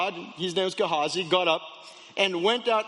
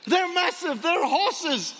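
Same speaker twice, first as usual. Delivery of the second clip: high, mounting alarm; calling the master in rising panic; acted frantic warning, climbing higher; panicked; 3 semitones higher than usual. Gehazi alerting his master to army.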